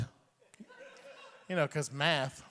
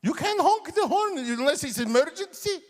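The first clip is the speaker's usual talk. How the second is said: put-on much higher, louder accented dad rule; stern dad; 12 semitones higher, 4 dB louder than usual. Dad scolding his son for honking, much higher. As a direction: lay down the law. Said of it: "He goes".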